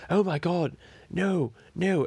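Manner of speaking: jury shocked as Michael drops his pants, high; mock horrified gasp. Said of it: "everyone would go".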